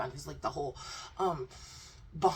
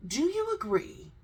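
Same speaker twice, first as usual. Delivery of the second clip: mock interviewer; 5 semitones higher, 8 dB louder, much slower than usual. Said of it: "so he was asked".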